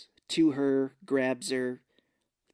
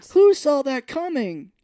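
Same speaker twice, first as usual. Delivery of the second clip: high, loud, slow sarcastic exclaim; loud sarcastic gasp line; mock shock; 12 semitones higher, 10 dB louder, slower than usual. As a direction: sell the fake surprise loudly. Mocking the plot twist.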